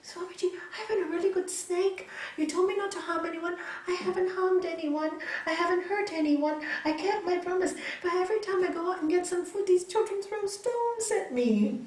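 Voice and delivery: high, protesting little snake voice; plaintive snake. Acted. Battered snake explaining itself to the swami, high plaintive protest.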